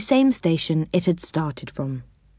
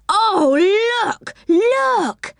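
put-on very high, thrilled girlish cry; excited yell; 14 semitones higher, much slower than usual. A narrator's reading. Franny spots six elephants coming, yelling with excitement. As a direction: squeal it out high, full of excitement. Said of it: "yelled Franny with excitement".

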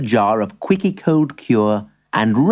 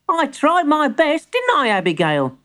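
put-on high storybook boy; defensive young grandson; 13 semitones higher than usual. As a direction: appeal to a friend as a small boy. Timbertwig defends himself.

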